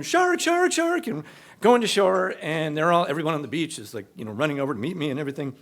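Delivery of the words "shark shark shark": high, loud panicked yell. Retold or imitated. Swimming to shore, yelling to the beach in panic. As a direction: shout the alarm toward the beach. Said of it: "I'm yelling".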